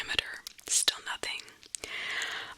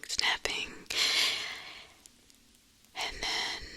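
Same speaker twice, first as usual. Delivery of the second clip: ordinary delivery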